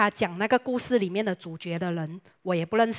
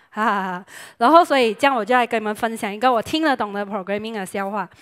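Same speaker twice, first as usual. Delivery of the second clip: usual speaking voice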